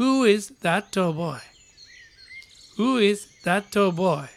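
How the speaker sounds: very slow, drawn-out English; teacher's slow repeat-after-me read; slow model for repeating. Shown as a demonstration. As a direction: model it slowly for the class to repeat. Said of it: "リピートしましょう".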